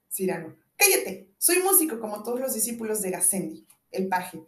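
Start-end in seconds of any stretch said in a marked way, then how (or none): 0.8-2.0 loud, higher snap to silence; sharp shout. Acted. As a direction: cut him off loudly.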